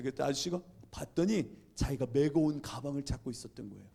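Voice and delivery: ordinary talk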